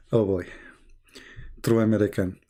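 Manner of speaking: ordinary talk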